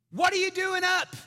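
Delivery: high, very loud parental outburst; exasperated yell. Retold or imitated. Dad to daughter out of bed, high exasperated shout. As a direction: yell it up the stairs in disbelief. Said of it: "in the nicest way possible".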